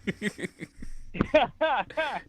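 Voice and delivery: high-pitched chuckle; quiet, high teasing laugh. Real, not acted. Host teases caller about hating.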